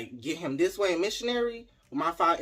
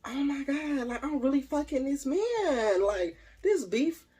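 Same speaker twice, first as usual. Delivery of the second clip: mock amazement; 9 semitones higher, 4 dB louder, slower than usual. Retold amazed inner thought.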